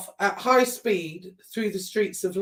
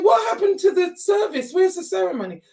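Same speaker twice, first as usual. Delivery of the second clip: indignant shout; 10 semitones higher than usual. Retold or imitated. Daughter confronting the men about the service, shouting. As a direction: call out the missing service, indignant. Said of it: "I said".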